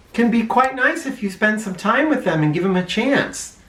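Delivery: plain reading voice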